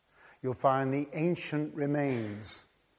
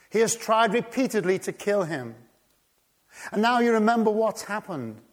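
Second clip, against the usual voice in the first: loud, higher, building preacher's cry; preaching at full force; 8 semitones higher, 7 dB louder than usual. Acted. Preacher stirring the congregation, full-force preaching. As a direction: drive it home from the pulpit.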